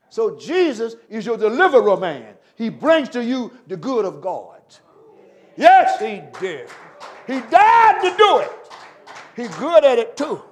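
loud, full-voiced preaching; fiery preacher on a roll. Real, not acted. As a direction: drive it home with power.

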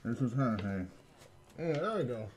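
muffled under a mask; inside a rubber mask. Real, not acted. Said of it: "you sound hilarious".

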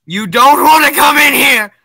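loud, high yell; game-panic holler; shouted warning. Pretend. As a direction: shout a warning to keep them out. Warning others away from the church.

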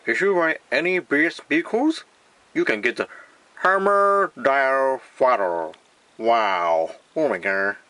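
hammed-up English ad read; exaggerated English commercial voice; mock English accent. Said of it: "英語っぽく言ってみます".

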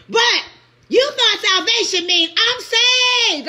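high, loud and slow preaching; slow preaching shout. Real, not acted. Preacher mocking the saved, slow and loud. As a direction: stretch every word out like a sermon's climax.

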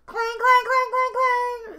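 loud, high rapid clanging; screeching trolley clang. Acted. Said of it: "screeched a trolley car".

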